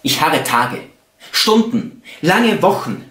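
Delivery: slow, expressive poem reading with long pauses; poetry recitation. Acted. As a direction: recite love poetry, swelling and falling with each phrase. Recites love poems.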